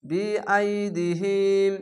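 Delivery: বি আই দি হিম read slowly, the long vowel stretched one alif; elongated vowel. Shown as a demonstration. Teacher reading a word to students, slow with the vowel stretched. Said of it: "এক আলিফ টান".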